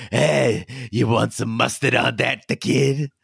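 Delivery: put-on a light Brooklyn accent like a deli counter guy; lightly voiced Brooklyn deli man offering mustard. Co-host mocking the breather's voice, light Brooklyn accent. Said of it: "some guy that worked the deli in, like, Brooklyn".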